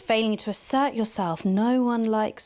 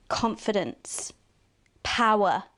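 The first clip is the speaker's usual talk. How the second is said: ordinary talk, nothing marked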